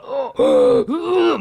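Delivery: high, strained gasping "oh"; mock choking. Patient retelling choking on medical mouthwash, imitated high choking gasp. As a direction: act out choking on a swig of mouthwash. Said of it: "just started coughing and choking on it".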